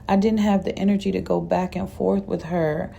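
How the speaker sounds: ordinary delivery, nothing marked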